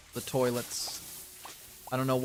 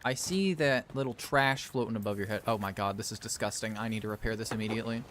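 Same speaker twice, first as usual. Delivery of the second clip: ordinary delivery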